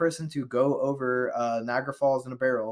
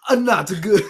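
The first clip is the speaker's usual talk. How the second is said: higher, louder, mock serious "not good"; mock commentator; 7 semitones higher, 10 dB louder than usual. Parroting friend's verdict as a mock commentator. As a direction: ham up the dry verdict. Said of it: "That's our stellar commentary".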